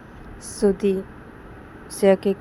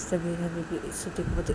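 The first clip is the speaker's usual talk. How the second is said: ordinary delivery, nothing marked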